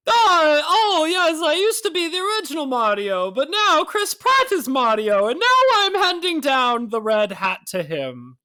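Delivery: high cartoon Mario voice, slowing; wistful old plumber handing over. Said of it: "Where it's like, like".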